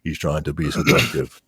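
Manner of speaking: low, flat robotic delivery; monotone robot voice. Shown as a demonstration. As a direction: mimic a robotic seducer in monotone. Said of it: "talking like this in monotone".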